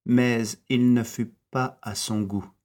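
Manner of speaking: plain reading voice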